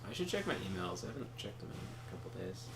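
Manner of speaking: usual speaking voice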